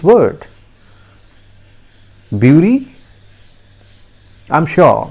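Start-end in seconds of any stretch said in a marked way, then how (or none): 2.3-2.9 "Bury" as BYOO-ree, rising; wrong pronunciation. Shown as a demonstration. Teacher quizzing learners with a mistake, "Bury" as BYOO-ree. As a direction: ask "Bury" with a yoo vowel. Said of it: "That is a wrong pronunciation".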